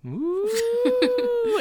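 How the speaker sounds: long high falsetto audience ooh. Retold or imitated. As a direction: coo like a teasing studio audience. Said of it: "that's what the audience does".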